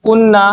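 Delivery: কুন্না with a held double n, falling; teacher models কুন্না, nn stretched; doubled n. Shown as a demonstration. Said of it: "কিন্তু এটা কুন্না". (কুন্না, দ্বিত্ব ন টেনে উচ্চারণ)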